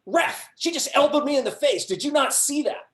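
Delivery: high, loud, indignant complaint; angry player. Retold or imitated. Player in the ref's face after an elbow, high and loud. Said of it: "she got in my face".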